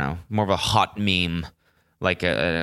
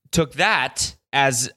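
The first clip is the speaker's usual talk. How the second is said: ordinary delivery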